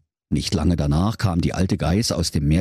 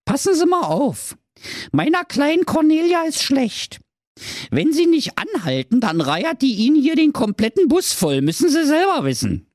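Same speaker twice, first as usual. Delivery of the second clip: high cunning mother voice; high bossy threat to driver; scheming mother goat. A narrator's reading. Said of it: "sagte zum Busfahrer".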